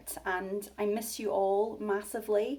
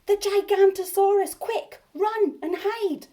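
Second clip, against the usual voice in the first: high, loud panicked shout; fake alarm cry; 11 semitones higher, 10 dB louder than usual. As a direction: yell the warning like it's real. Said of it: "Bonehead cried".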